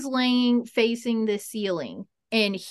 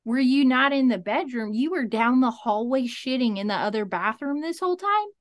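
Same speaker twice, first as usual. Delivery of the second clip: alarmed retelling; 3 semitones higher than usual. Retold or imitated. Alarmed quote to husband.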